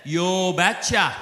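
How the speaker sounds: slow, drawn-out Norwegian "Yo betcha"; Norwegian accent. Retold or imitated. Norwegian father agreeing to pay the deal, in a slow Norwegian accent. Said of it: "in his thick Norwegian accent".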